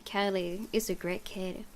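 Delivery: plain reading voice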